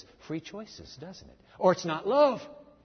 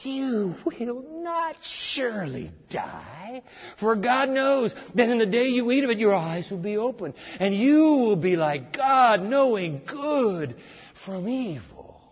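sly serpent voice; 6 semitones higher than usual. Acted. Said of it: "Then the serpent said to the woman".

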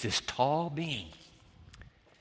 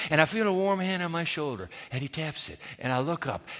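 usual speaking voice

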